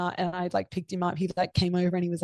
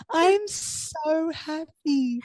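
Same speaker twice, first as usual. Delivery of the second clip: very slow, much higher, dreamy joy; drawn out like you're floating; euphoric, stretched words; blissed out; 9 semitones higher, much slower than usual. Retold or imitated. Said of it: "I was like".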